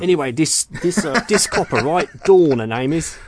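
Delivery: high, loud mock-cockney copper talk; jokey hammed-up London accent; exaggerated British accent. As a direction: put on a cockney geezer voice. Hamming up mocked British accent.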